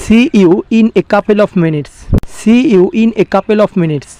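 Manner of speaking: very slow, careful English; slow model sentence. Shown as a demonstration. Teacher giving the English translation, slow and deliberate. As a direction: lay each word out slowly. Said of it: "আমি ইংলিশে কি বলছি দেখো".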